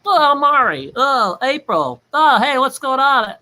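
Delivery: a high goofy imitation of Mari. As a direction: squeal hello like a teasing friend. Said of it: "I'll do your voice again".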